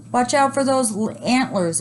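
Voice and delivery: high alarmed warning; scared scream. A narrator's reading. Lady warning about antlers, high scared cry. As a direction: shriek a warning. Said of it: "a lady screamed".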